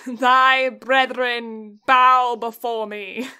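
slow, loud regal command; dark lord. Pretend. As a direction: command it like a tyrant king. Fantasy lord boast bit.

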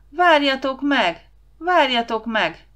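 high, loud drawn-out call; desperate shouting. Acted. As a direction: shout desperately after someone running away. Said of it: "kétségbeesett hangon kiabált utánuk".

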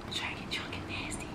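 soft, unvoiced bedroom whisper. Boyfriend whispering a naughty request, hushed whisper. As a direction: breathe it as a secret request. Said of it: "Stop whispering".

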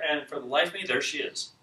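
ordinary delivery

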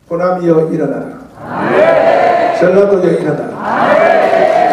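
loud preaching cry to rise up; rallying shout. Real, not acted. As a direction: call the region to stand up, full voice. Preacher calls region to rise.

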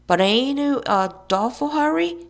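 high mock-girlish shout; shrill, jeering call; shouted taunt. A narrator's reading. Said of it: "shouted Billy".

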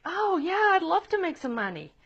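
put-on an eager kid jumping at the offer. A kid keen for pocket money, excitedly. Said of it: "And they're like".

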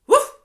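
very high, loud yappy woof; loud yappy bark; dog woof. Acted. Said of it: "said the dog".

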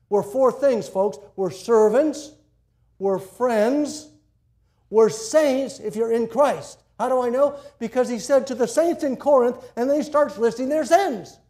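loud, high pulpit preaching; full-force preaching. Acted. Pastor preaching loudly to the congregation about sin. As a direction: preach it out to the whole room.

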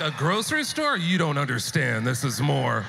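from shock to loud insistence; incredulous then emphatic. Retold or imitated. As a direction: push it up with disbelief, then land firm. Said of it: "They're like".

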